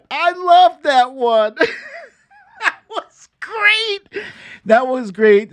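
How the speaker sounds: high, squealing laughter through the words; real laughing delight. Real, not acted. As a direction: crack up while saying it, pitch way up. Cracks up recalling the comeback.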